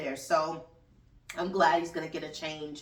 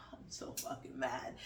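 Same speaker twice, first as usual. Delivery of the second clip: whispered, much softer aside; 5 semitones lower, 12 dB quieter than usual. Real, not acted. Host confides her anger to listeners, whispered. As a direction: hush it like a secret confession.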